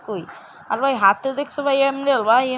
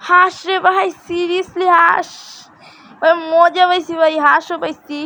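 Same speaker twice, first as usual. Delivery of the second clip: higher and louder excited chatter; 6 semitones higher, 7 dB louder, slower than usual. Real, not acted. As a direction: gush about the find, drawing out seriously. Excited about finding a duck. (উঁচু গলায় উত্তেজিত)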